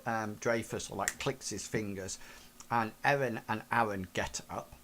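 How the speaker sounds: usual speaking voice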